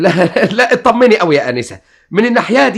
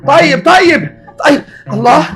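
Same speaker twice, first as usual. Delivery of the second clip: startled outburst; 10 semitones higher, 7 dB louder, slower than usual. Acted. Waking up startled.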